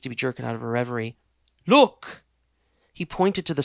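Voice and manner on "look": loud startled cry; alarmed call. Acted. Richard points at the body, loud and alarmed. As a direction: shout to make her notice.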